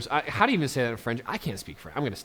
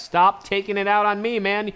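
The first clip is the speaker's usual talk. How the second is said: mock indignant; 9 semitones higher, 7 dB louder than usual. Arguing with angry AI leader.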